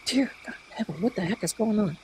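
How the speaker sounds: very soft, under-the-breath aside; hushed inner exclamation. Retold or imitated. Hotel guest thinking during evacuation, hushed retold exclamation. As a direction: drop it to a hushed thought to yourself. Alarmed private thought, retold.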